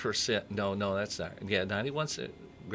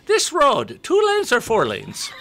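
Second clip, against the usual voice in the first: very high, much louder squeal; shouted leprechaun punchline; 12 dB louder, slower than usual. Acted. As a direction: belt the punchline like a furious elf. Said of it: "great leprechaun voice".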